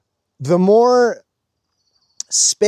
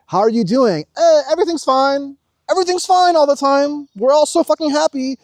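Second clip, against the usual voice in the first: much higher, louder fake cheerful voice; 13 semitones higher, 6 dB louder than usual. Everyone pretending to be happy, high fake cheer. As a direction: chirp it like everything's fine. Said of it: "'Cause everyone's like".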